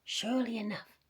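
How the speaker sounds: usual speaking voice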